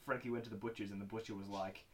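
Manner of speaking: usual speaking voice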